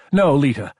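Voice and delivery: loud, high outburst; sharp refusal. A narrator's reading. Lieutenant spinning away from comfort, sharp refusal. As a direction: burst out and push her off. Pulls away from her, fists clenched.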